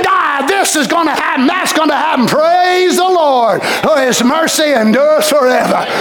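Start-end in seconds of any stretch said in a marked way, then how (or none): 2.3-5.9 shouted battle cry. Acted. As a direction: call it out like a war cry. Shouted battle cry against devil.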